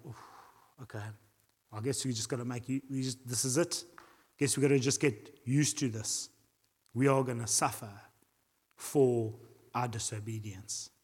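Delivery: low, subdued acted acceptance; understated voice of people giving up; resigned. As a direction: sigh into it, quiet and mildly defeated. Imagined Israelites resigning themselves to suffering.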